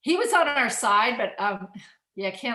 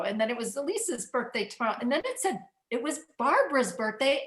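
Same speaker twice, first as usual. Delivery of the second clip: normal talking voice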